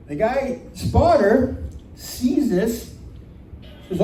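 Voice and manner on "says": usual speaking voice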